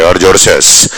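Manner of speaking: ordinary talk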